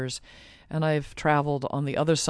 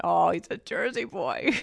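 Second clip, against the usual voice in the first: mock-astonished discovery; amused realization; 3 semitones higher than usual. Woman recalling her reaction to his accent, amused surprise. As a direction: retell it with a delighted touch of disbelief.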